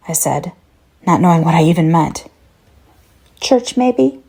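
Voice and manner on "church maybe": higher, sing-song imitation to irritate; mocking child mimic. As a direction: parrot him like a bratty kid. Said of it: "like a child is imitating your voice".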